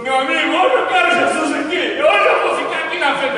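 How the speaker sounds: grito alto e mais agudo de entusiasmo; ansioso gritando. Retold or imitated. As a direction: gritar que agora quer ir à frente. Visitor now eager, shouting. (visitante rendido gritando ao amigo)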